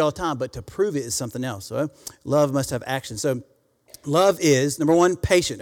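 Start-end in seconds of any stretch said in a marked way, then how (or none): 4.0-5.6 emphatic sermon cadence; rhythmic pulpit delivery; preaching. Acted. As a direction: preach each word of the list. Preaching the love list.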